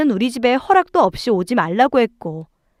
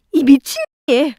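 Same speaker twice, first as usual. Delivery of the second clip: short acted outburst, raised pitch; angry yell; 4 semitones higher than usual. A narrator's reading. Sister-in-law yelling at the wife, angry outburst. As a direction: lash out with an insult. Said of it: "큰 소리를 질렀고".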